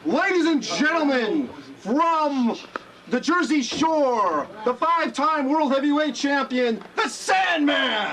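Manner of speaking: booming, high, slow ring announcer. Shown as a demonstration. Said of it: "I'm the best".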